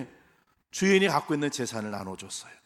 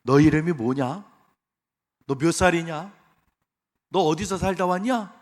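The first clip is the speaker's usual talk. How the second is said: mock God asking; 4 semitones higher, 6 dB louder than usual. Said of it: "하나님이 우리에게 이제 물어보실 거예요".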